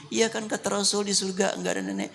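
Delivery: fretting grandma, sped up; whiny grandmother. Retold or imitated. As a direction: complain like a tearful old woman. Grandmother complains while crying.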